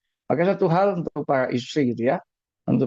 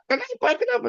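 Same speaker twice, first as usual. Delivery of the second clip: high, mocking "Kenapa? Kenapa?"; high mock protest; mock indignant why. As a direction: play a resentful spouse asking why, pitched high. Mock complaint about another's guidance.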